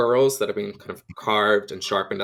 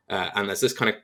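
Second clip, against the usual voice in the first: usual speaking voice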